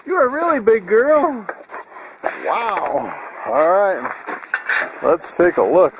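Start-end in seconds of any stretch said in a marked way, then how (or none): 0.1-1.4 high-pitched gushing to a pet; cooing to dog. Real, not acted. Owner to pregnant dog, high-pitched amazed cooing. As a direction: coo in amazement, much higher.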